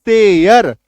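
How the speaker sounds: सेयर said as say-ur, ending in yar; clear pronunciation demo. Shown as a demonstration. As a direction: sound out सेयर clearly with a falling end. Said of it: "तो उच्चारण देखिए क्या हो रहा है". (सेयर का साफ उच्चारण, यर की ध्वनि के साथ)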